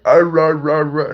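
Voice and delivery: loud, high mock croak of garbled noise; imitated wrecked voice. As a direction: imitate a shot voice failing to form words. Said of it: "first thing out of my mouth sounded like".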